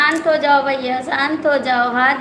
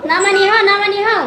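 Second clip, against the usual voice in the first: loud burst; 6 semitones higher, 5 dB louder than usual. Real, not acted. Sudden burst during live chat.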